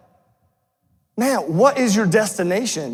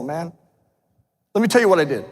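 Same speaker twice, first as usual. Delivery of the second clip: normal talking voice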